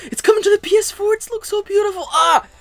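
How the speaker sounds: very high, giddy outburst of fan joy; high-pitched fanboy gush; gushing fan squeal. Real, not acted. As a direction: squeal it way up high, overcome by how gorgeous it is. Gushing over Monster Hunter.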